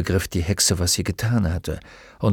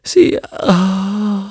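one word at a time, high and strained; gasping, broken fragments rising to a cry; tortured, halting speech; 10 semitones higher, 3 dB louder than usual. Acted. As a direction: force each word out through agony. Tortured witch forces out words.

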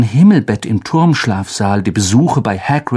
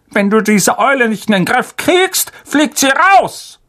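blustering uncle; 12 semitones higher than usual. Acted. Uncle threatening nephew about the owl, blustering higher. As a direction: bluster the threat, landing hard on raus. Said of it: "polterte er".

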